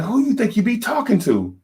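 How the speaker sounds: high, sharp, offended squeal; mock the wife's outraged pitch; a wife huffing in the grocery store, high and offended; indignant wife. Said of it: "She's like".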